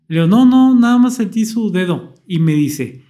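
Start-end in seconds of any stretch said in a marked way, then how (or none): 0.3-2.1 raised, louder insistence that it only felt a finger; emphatic denial. Retold or imitated. Patient protesting to the doctor, emphatic. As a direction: protest eagerly, voice up high.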